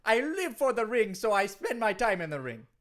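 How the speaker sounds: lilting put-on Jamaican wrestler; hammed-up Jamaican accent; Kofi Kingston, Jamaican accent. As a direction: protest in a mock Jamaican accent. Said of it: "you sound like you're from someplace else".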